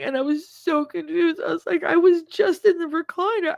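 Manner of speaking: crying, very high-pitched voice; tearful apologizing. Real, not acted. Woman reliving her apologies to the man who caught her, crying. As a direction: choke out frantic apologies through tears.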